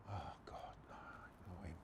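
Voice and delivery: low, whispered dismay; hushed retold aside, sinking; dreading mutter. Muttered dread as shadow appears.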